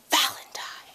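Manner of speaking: dramatic whisper. Retold or imitated. Vlogger revealing who Michael really is, dramatic whisper.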